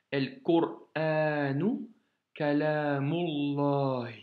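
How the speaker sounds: El Qur'anu Kalamullah, slow and linked; liaison. Shown as a demonstration. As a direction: read slowly, joining Kalamullah. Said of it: "nous devons faire la liaison". (El Qur'anu Kalamullah, lentement, avec liaison)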